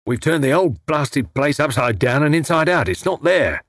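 hoarse ruffian. A narrator's reading. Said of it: "said the taller of the two hoarsely".

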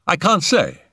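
high-pitched helpless reply; Settle, uneasy. Acted. Settle answering who is menaced, high and uneasy. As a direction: give up on it in a high voice.

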